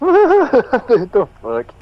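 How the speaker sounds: high laugh spilling into the words; laughing disbelief. Real, not acted. Player cracking up at the game master's ruling, laughing. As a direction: crack up, then get the words out.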